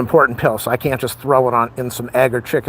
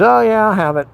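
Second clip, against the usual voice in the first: high, airy imitation of mom's breezy yes; pitched-up mock mom voice; mom's reply; 10 semitones higher, 5 dB louder than usual. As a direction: mimic mom's careless agreement. Mom answering about pizza.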